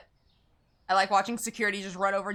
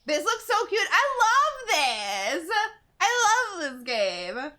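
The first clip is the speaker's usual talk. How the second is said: gushing; 8 semitones higher, much slower than usual. Real, not acted. Gushing over cute game.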